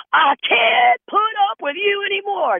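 very high mock wife voice. Fed-up wife telling her husband, squeaky high.

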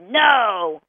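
NO loud and drawn out; a slow, forceful "no"; firm no. Shown as a demonstration. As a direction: hit "no" hard and stretch it. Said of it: "it doesn't sound the same".

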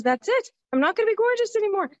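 put-on high, fast wail of a dismayed young self; mock young despair. To herself at the pregnancy test, high mock despair. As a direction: play the girl who thinks her looks are gone. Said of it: "the first thing I thought was".

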